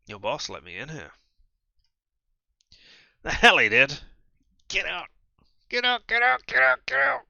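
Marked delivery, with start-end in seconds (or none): 3.2-5.1 much louder, a furious yell; furious shout. Acted. Woman angry at intruder. 5.7-7.2 high, loud screaming repeats. Acted. Woman screaming at intruder.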